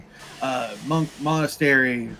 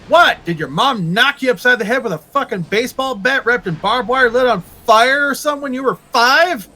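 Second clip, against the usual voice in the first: incredulous outburst; 7 semitones higher, 6 dB louder than usual. Acted. Incredulous rant at imagined person.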